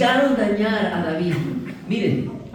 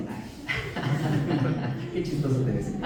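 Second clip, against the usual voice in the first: soft, laughing mockery; amused soft chuckle while speaking; 6 semitones lower, 5 dB quieter, much slower than usual. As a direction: laugh at the one who sneers.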